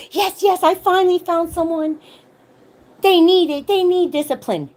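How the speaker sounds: high, loud mock of a gleeful mother; mocking gleeful mom. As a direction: ham up her giddy delight. Mocking the mom's gleeful bathroom reaction.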